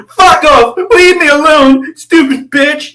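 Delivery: very high, loud, crying mock voice; shrill mock crying rant; Hunter Avalone impression. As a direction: shriek it like a hysterical imitation. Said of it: "I sounded like Hunter Avalone".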